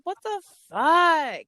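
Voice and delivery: high, loud, drawn-out disbelief; imitating a stunned outburst; retold shock. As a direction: replay the moment it hit you. Retold shock at being reported.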